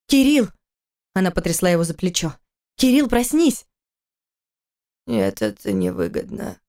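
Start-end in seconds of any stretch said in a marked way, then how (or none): 0.1-0.5 higher, louder urgent call of the name; calling his name. Acted. Wife calls her feverish husband's name in alarm. 2.7-3.7 higher, pleading call to wake; urgent wake-up call. Acted. Wife tries to rouse her delirious husband, urgent call. 5.1-6.6 much lower, slow sleepy mumble; delirious mumble. A narrator's reading. Feverish husband mumbles in his sleep, low slow mumble. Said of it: "пробубнил он".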